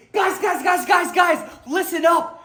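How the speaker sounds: high, loud shouts for attention; eager, loud call to the gang; calling out excitedly. Pretend. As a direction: yell to get the group's attention. Excited character calls out.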